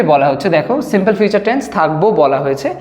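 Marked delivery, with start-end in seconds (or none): none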